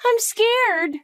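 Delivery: second, stronger cry of fear to her mom. Acted. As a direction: let the fear rise on the repeat. Child voiced by the adult.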